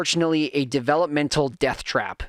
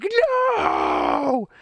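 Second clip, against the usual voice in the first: put-on high, slow, wailing kid voice; wailing child; much slower than usual. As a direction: mimic a crying child's protest. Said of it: "Titus was a wreck".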